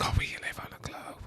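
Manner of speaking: imitating a secretive whisper, voiceless; mock whisper. Reactor mocking the video maker's secret, mock whisper. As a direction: whisper it as if it were a big secret. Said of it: "Why would you have to whisper".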